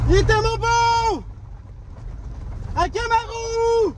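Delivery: long high hail to a lost dog; calling out. Real, not acted. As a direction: call the lost dog from far off. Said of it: "ça fait une heure que je crie".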